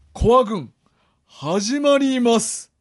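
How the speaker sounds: loud drawn-out show title call; title callout. Acted. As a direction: announce the show title slowly and loudly.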